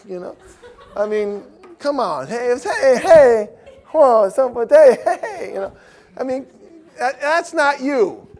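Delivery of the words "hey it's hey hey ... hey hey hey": high, loud hey-hey; loud, high put-on greetings; mock greetings. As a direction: ham up a try-hard. Mocking a poser's greetings.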